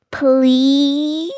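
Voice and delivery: long, high, wheedling plea; drawn-out childish pleading; begging. A narrator's reading. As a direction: stretch the word out high like a begging kid. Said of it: "Henry begged".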